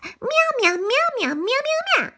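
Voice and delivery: very high sneaky meowing; high cat meows. Pretend. Siamese cats meowing high and sly.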